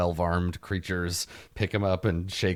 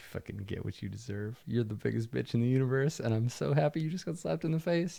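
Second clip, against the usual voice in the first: gloating; 4 semitones higher, 4 dB quieter than usual. Acted. Lady protector gloats at the player.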